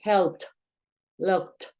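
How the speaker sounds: "helped" and "locked" as "helpt" and "lokt"; stronger final T. Shown as a demonstration. Teacher shows when T sounds stronger, crisp ending. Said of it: "va a sonar más fuerte".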